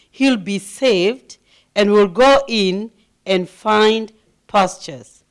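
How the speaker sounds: loud, forceful sermon reading of scripture; loud, slowed, emphatic pulpit reading; preaching scripture reading. Acted. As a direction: proclaim it to the congregation at full voice. Reads verse seven aloud.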